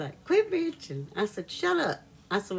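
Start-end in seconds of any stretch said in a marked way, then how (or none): 0.2-1.1 high-pitched mimicking voice; mock complaint, pitched high; imitated teasing retort. Retelling husband's laughing comeback. 1.5-2.0 high-pitched playful comeback; retold squabble, pitched high; mock indignant retort. Acts own retort in retold squabble.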